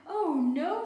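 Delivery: high, loud exasperated cry. A narrator's reading. Mister Bear kept awake, loud exasperated cry. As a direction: wail it in frustration. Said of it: "said Mister Bear".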